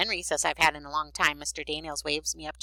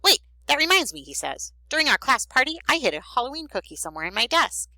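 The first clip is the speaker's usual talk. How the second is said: put-on loud, high-pitched excited boy; sudden excitement; 9 semitones higher, 6 dB louder than usual. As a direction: burst in with a sudden memory. Boy suddenly remembers hidden cookie.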